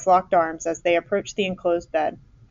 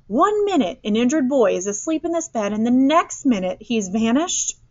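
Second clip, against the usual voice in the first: higher-pitched indignant outburst; erupting; 6 semitones higher than usual. A narrator's reading. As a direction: let outrage climb toward the last word. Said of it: "Mister Eberhardt erupted".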